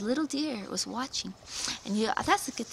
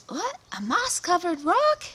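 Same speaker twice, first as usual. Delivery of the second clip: high, loud gasping "A moss-covered rock?" rising at the end; shocked gasp; 9 semitones higher, 7 dB louder than usual. Acted. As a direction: sound stunned as you spot the rock, with "rock" rising high. Visitor discovers the magic rock.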